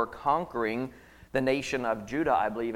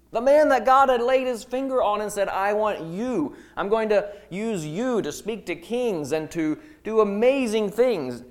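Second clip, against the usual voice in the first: fervent proclamation; 9 semitones higher, 5 dB louder than usual. Acted. God's call, proclaimed high.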